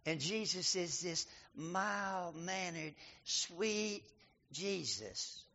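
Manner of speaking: mock the soft sweet Jesus picture, slowly; mocking mild Jesus. Preacher mocking the popular mild Jesus, soft mock-sweet voice. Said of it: "here's the pictures a lot have of Jesus".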